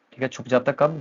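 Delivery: ordinary talk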